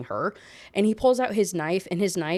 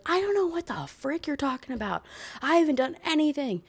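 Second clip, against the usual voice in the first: indignant heroine; 7 semitones higher than usual. Retold or imitated. Voicing heroine's indignant denial.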